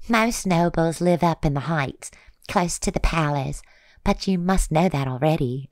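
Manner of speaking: a chatty market lady; older woman vendor voice. Acted. Older Fey vendor woman speaks.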